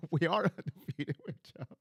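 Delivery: words broken up by laughter; laughing. Real, not acted. Host reading a viewer's comment about Chubb, laughing. Cracks up while reading chat.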